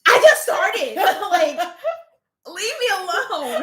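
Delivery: high, loud wail through laughter; mock pleading protest. New creator protests to commenters, laughing mock plea.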